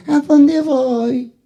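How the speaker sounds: voz de ovejita, muy aguda y alargada; quéjate como una ovejita perdida, en tono agudo; ovejita indefensa. Acted. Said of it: "¿Qué soy yo, la ovejita?". (vocecita de oveja)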